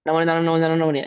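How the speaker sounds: hurried gabble, words run together; careless rushed recitation. Shown as a demonstration. Teacher showing how not to recite, hurried and slurred. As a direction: rattle the mantra off without separating the words. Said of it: "ऐसा नहीं".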